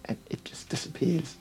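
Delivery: hushed secretive whisper; soft mysterious whisper. Acted. Secretive sales pitch on mask.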